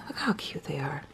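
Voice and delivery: soft whispered aside. Real, not acted. Mother over the sleeping twins, whispered. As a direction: breathe it without voice.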